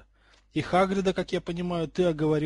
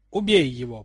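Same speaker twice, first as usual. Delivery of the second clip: hissed command; 7 dB louder than usual. A narrator's reading. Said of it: "прошипел юноша".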